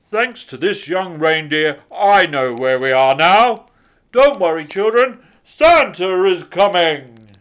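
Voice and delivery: loud, hearty Santa voice, slowing at the end; loud jolly Santa reassuring children. A narrator's reading. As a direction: boom it out like Father Christmas.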